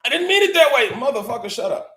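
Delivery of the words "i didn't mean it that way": whiny mock excuse. Mocking someone backpedaling.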